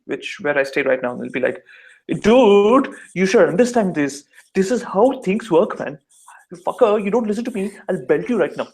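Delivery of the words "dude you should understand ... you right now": aggressive Delhi accent. Shown as a demonstration. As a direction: come at them hard in a Delhi street accent. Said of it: "really aggressive".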